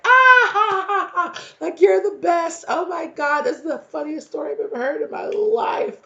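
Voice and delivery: loud high cackling laugh, over the top; hammed-up shrieking laughter at a funny story; mock crowd roaring with laughter; obnoxious laughing.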